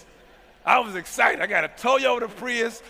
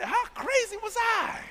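self-mocking disbelief; 13 semitones higher, 4 dB quieter, slower than usual. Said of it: "I said".

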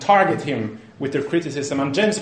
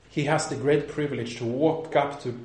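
usual speaking voice

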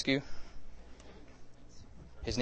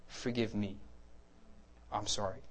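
soft, gentle plea for forgiveness; sincere apology; 3 semitones lower, 10 dB quieter than usual. Retold or imitated. Preacher asking John for forgiveness, softly sincere. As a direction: apologize quietly and earnestly. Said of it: "And I was sincere".

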